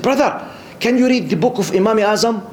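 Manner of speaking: high-pitched, insistent brother putting a question; challenging questioner. Retold or imitated. Man in the mosque tests the newcomer after prayer, challenging. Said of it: "He was asking me in this attitude".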